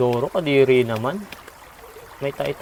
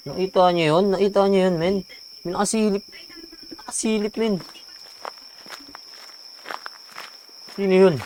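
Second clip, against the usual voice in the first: high, alarmed questions; scared; 8 semitones higher than usual. Real, not acted. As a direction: voice rising in fright. Spotting a peeking figure.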